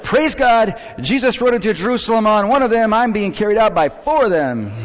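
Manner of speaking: high, loud imitated jubilant shouting; shouted praise. Lady being carried out of church, shouting praise. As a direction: holler it joyfully over the congregation. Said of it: "Well, the lady shouted".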